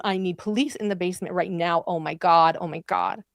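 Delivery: ordinary talk, nothing marked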